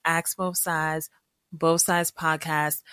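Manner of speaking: slow, clear hashtag plug; slow spelled-out plug. Real, not acted. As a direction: drag each word out for the hashtag. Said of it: "use the hashtag".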